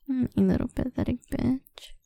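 ordinary delivery

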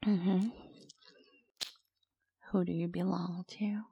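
usual speaking voice

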